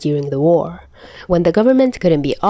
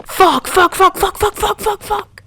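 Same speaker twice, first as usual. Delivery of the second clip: high frantic repeated curses, fast; panicked yelling; 13 semitones higher than usual. Acted. Stranger running out of the building, yelling in panic. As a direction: yell it while fleeing in panic. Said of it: "a person running and yelling".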